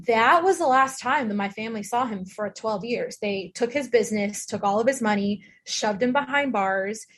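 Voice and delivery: ordinary talk, nothing marked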